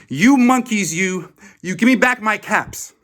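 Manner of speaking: furious peddler shouting up the tree; angry shout. A narrator's reading. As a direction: bellow at the monkeys. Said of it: "very angry".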